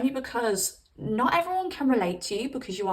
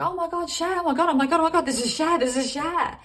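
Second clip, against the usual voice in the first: giddy fan; 7 semitones higher, 4 dB louder, faster than usual. Retold or imitated. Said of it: "You're gonna be like".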